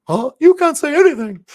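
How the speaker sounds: squeaky high cartoon mouse, loud. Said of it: "I used to be able to do the mouse".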